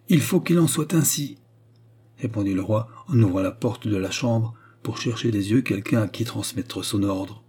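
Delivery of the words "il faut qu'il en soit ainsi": high, emphatic royal decree; agitated, high-pitched insistence; insistent. A narrator's reading. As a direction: overrule the protest, voice pitched up. Agitated king overrules the protest.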